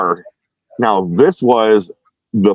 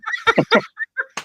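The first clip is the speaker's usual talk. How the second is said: laughing; 5 dB quieter than usual. Real, not acted. Laughing at the duck call story.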